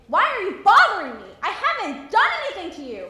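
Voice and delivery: loud, high outburst, pitch swooping; indignant protest. Acted. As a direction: cry out at a man who keeps harassing you. Acted challenge to the harassing man.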